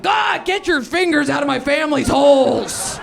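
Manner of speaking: angry shout at the dentist; furious yell. Retold or imitated. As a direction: yell it at her, fed up and laughing. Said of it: "I'm like".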